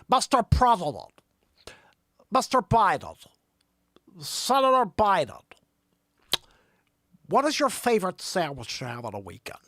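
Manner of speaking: insistent TV moderator, starting high and loud; Chris Wallace. Acted. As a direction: address the candidates in a put-on moderator voice. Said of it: "that's dead on Chris Wallace".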